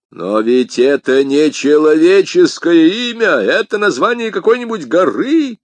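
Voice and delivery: loud, high, losing his head; exasperated exclamation. A narrator's reading. Commissar balks at the musketeer's name, exasperated. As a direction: build to a high flustered outcry. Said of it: "воскликнул несчастный комиссар, начинавший терять голову".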